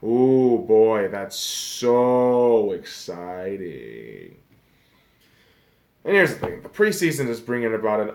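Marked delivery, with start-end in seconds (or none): 0.0-4.4 very slow, dragged mock excitement; sarcastic drawn-out enthusiasm. Vlogger dragging out fake preseason excitement. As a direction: stretch every word in bored sarcasm. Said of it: "You can hear the enthusiasm in my voice".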